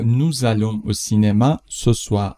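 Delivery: slow, careful dictation pace; slow model read. Shown as a demonstration. Teacher reads the French sentence slowly for the class. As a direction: spell it out slowly for learners. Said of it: "teacher models the sentence slowly".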